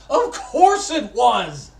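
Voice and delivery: loud, slow, high exclamation; shouting. Real, not acted. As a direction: belt it out indignantly. Mock outrage, shouted.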